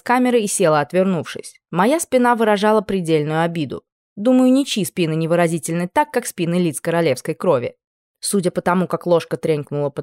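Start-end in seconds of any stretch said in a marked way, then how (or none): none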